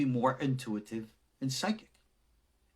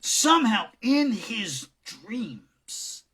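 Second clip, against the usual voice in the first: slow, drawn-out; 9 semitones higher, 7 dB louder, much slower than usual. Acted. Dramatic storytelling.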